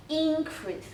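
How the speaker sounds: increase with IN high and strong, then falling; stress on first syllable. Shown as a demonstration. Teacher showing the way to read increase, front-stressed. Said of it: "emphasis should at the beginning".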